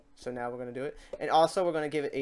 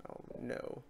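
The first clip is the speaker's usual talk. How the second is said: whispered oh no; 2 semitones lower, 11 dB quieter than usual. Real, not acted. Dismay at a wrong click.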